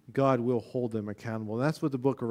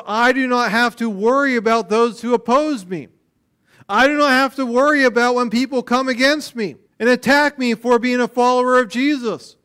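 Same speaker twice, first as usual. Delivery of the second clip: loud, high, ringing proclamation; a preacher's big ringing declaration; preaching at full force; 11 semitones higher, 10 dB louder than usual. Acted. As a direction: proclaim it to the whole room. First reading of the promise.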